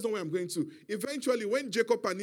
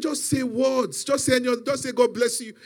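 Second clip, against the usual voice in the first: raised, loud, exasperated begging; insistent pleading; 4 semitones higher, 5 dB louder than usual. Retold or imitated. Son begs his father for any words at all, insistent and loud. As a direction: push each request out higher and louder.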